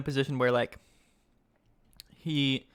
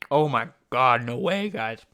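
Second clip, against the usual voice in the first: loud, disbelieving outburst; real shock, louder at the start; stunned; 7 dB louder, slower than usual. Real, not acted. Streamer shocked at the comeback, shouting to chat.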